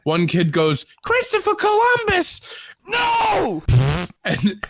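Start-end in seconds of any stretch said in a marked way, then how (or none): 1.0-2.4 put-on high kid voice. Kids naming snowman, high voice. 2.9-3.6 high shouted no; shouting kid voice. Retold or imitated. Kids rejecting name, shouted.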